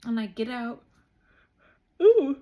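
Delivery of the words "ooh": loud open-mouthed yawn out of nowhere; big yawn. Real, not acted. Yawning mid-story to her camera, loud and long. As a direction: let a big yawn take over. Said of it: "I've never yawned on camera before".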